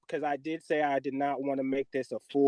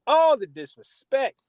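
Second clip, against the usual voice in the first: higher, louder and slower on ALL; louder outraged repeat; 9 semitones higher, 6 dB louder than usual. Real, not acted. Podcaster to fans, repeating the insult louder. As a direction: stretch out All the disrespect, outraged. Host ranting about rival team.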